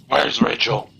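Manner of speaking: deep gravelly Batman growl; low gravel vigilante growl. Acted. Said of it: "He's Batman".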